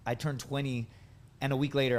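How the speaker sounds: usual speaking voice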